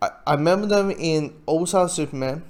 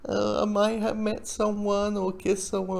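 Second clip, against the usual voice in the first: higher, mock-sheepish admission; guilty confession; 5 semitones higher, 4 dB quieter than usual. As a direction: imitate a guilty little confession. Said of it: "a little guilty".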